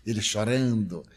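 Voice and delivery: usual speaking voice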